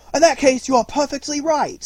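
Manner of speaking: very high, loud exaggerated courtesy; bow and agree with grand fake respect; mock-polite jailer. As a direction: jailer deferring to the self-declared criminal, high and loud.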